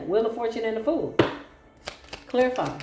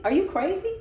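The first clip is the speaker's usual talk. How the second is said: incredulous; 10 semitones higher than usual. Real, not acted. Incredulous at the schemer.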